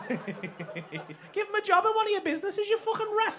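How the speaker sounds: high, laughing mockery; giggling jeers at a mate; laughing insults. Real, not acted. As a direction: laugh through the taunts. Mocking mate, laughing loudly.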